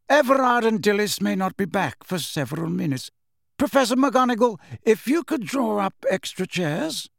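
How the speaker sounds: high-pitched, authoritative character voice; headmaster, instructing. Acted. Dumbledore asks Professor McGonagall for chairs, high and authoritative. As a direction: give courteous orders in a lifted voice.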